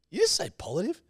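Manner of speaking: high-pitched retold question; retold query. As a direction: ask it high, imitating your earlier surprise. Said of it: "And I said".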